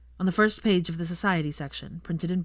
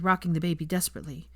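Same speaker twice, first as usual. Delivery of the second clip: usual speaking voice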